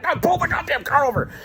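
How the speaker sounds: high-pitched urgent demand; mock-yell it high, no extra volume; retold yelling. Hyper passenger ordering the driver to stop, high mock yelling. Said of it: "I'm yelling at him".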